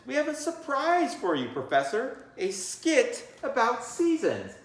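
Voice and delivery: put-on bright child voices presenting a skit; excited kids. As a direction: announce the surprise to the teacher. Kids in story announce skit.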